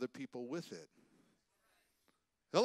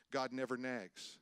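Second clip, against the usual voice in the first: ordinary delivery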